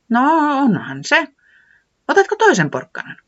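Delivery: high, friendly voice; Säämies offering. Acted. Säämies offering Tiputi a second carrot, high.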